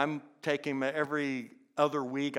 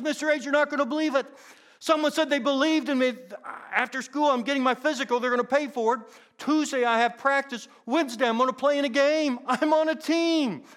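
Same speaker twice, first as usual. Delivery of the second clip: put-on much higher, louder, fast overjoyed boy; all excited; 12 semitones higher, 8 dB louder than usual. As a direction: burst with joy at the news. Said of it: "came into school all excited".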